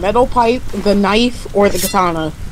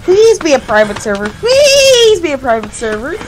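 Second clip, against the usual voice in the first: whiny pleading; 3 semitones higher than usual. Real, not acted. Player hoping for a private server, whiny begging. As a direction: wish for it hard, whining.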